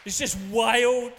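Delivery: loud ringing exclamation of wonder; exclaimed. Real, not acted. Preacher to congregation, loud amazed exclamation. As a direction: shout it out in amazement. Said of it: "preacher exclaims, much louder".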